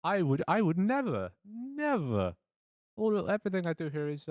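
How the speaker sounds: high-pitched, scandalized denial; mock indignation. Suspect insisting all is above board, mock indignant. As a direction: protest theatrically, pitched well up.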